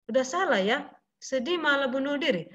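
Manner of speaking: ordinary delivery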